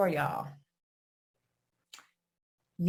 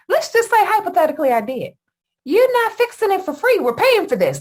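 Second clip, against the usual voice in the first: much higher and louder, fast and indignant; heated retort; 12 semitones higher, 6 dB louder than usual. Retold or imitated. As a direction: fire it back, offended and heated. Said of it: "because I'm pissed off and I'm heated now".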